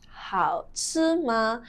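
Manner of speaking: slow, syllable-by-syllable question; slow demonstration. Shown as a demonstration. Teacher showing how to ask if food is delicious, slow demonstration. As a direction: draw out each syllable for learners.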